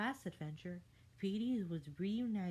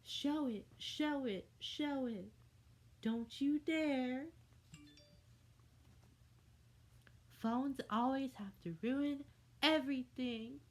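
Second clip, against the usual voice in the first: panicked protest; 7 semitones higher than usual. A narrator's reading. Fairy panics about clip.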